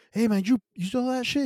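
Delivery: high-pitched, worked-up challenge; acted-out heated demand; heated confrontation. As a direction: call him out, retelling it heated. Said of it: "you know, heated".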